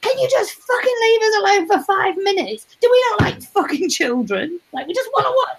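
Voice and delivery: high, strained mock rant; hammed-up exasperated posh outburst. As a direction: snap at the pestering waiter. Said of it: "he turns around and he goes".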